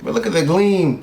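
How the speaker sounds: ordinary talk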